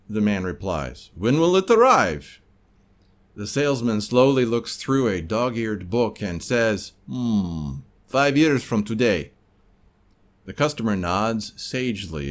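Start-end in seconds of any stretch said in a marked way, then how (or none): 1.1-2.4 put-on Russian accent. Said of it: "if you didn't like my Russian accent". 7.1-9.3 put-on unhurried Soviet clerk, Russian accent; drawn-out Russian accent verdict. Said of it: "if you didn't like my Russian accent".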